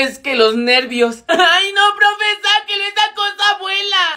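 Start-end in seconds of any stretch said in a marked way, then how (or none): 1.3-4.1 high, loud squeal of alarm; panicked pleading. Acted. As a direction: beg for the bug to be removed. Panicking over a flying bug. (súplica aguda de pánico)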